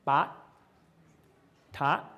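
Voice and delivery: bot then tot, each said deliberately; contrast demo. Shown as a demonstration. Teacher contrasting bot and tot. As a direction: start bot with lips, tot with tongue. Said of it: "you put a B at the beginning".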